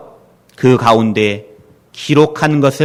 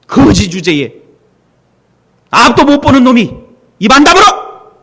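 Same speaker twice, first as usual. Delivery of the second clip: much higher, loud contemptuous rebuke; angry scolding; 4 dB louder than usual. Retold or imitated. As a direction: shut the beggar up with scorn. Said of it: "주변 사람들이 막 꾸지람을 했어요". (높고 크게 꾸짖는 군중의 호통)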